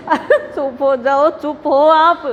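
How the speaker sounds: half-laughing call to hush; laughing mock shout. Daughter-in-law laughing, telling the wailing women to hush. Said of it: "ज़ोर से चिल्लाई हो".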